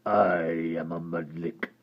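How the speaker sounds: low gravelly creature voice; hideous creature speaking low; deep creature voice. Acted. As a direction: rumble it like a hideous lake creature. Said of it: "said the hideous creature".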